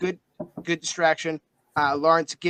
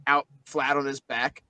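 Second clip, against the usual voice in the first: normal talking voice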